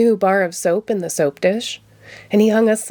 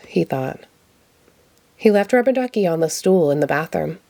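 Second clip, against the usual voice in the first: ordinary delivery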